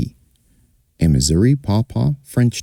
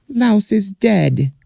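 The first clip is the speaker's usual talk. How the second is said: much higher, tiny flea voice; slower than usual. Acted. Flea telling the dog her sorrow, high voice. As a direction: squeak it out as the grieving flea.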